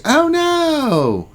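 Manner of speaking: very high, drawn-out falsetto wail; mock dismay. Streamer wailing over a viewer's collapsed guild, mock dismay. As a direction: play up a squeaky lament.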